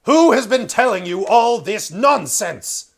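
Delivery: high and booming, the king roaring; voice of thunder. Acted. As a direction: blast it out like thunder. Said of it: "I can do a better voice of thunder".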